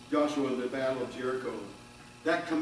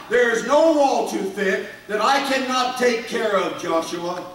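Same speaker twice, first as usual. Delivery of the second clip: loud, high preaching, booming God's words to Joshua; fiery preaching; 5 semitones higher, 6 dB louder than usual. Acted. Preacher voicing God promising Joshua victory, booming. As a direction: preach it with full force to the congregation.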